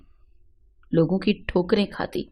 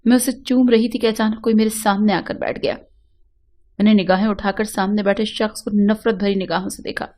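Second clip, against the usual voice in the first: plain reading voice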